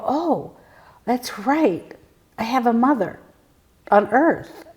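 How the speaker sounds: lightly acted "oh, of course" moment; retold sudden realisation. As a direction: act out suddenly remembering, voice lifted.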